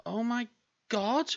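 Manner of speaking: high, aghast outcry; shocked exclamation. Real, not acted. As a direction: gasp it out high in disbelief. Panicking at lost power.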